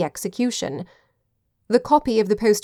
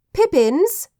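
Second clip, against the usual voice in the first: high, rising summons; calling out; 9 semitones higher, 4 dB louder than usual. A narrator's reading. Ginger calling for her long-time butler, calling out. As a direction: call down the passage for the butler. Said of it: "calling for her long-time butler".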